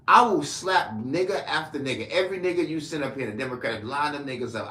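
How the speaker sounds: loud, heated threat to the critics; angry threat. Real, not acted. Angry man threatening his online critics, loud and heated. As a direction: snap out the threat like a dare.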